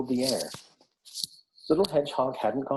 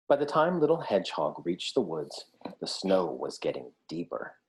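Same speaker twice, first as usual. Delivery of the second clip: ordinary delivery, nothing marked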